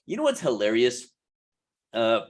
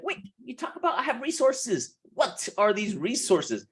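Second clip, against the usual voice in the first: higher, puzzled viewer voice; imitating viewer; 7 semitones higher than usual. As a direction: play a confused viewer asking. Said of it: "You're like".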